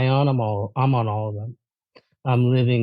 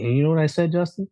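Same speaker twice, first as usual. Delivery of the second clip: ordinary delivery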